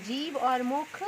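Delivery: जीभ and मुख said slowly; slow, emphatic. Shown as a demonstration. Teacher to pupils, stressing tongue and mouth slowly. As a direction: spell out the key words. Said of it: "क्या करना पड़े".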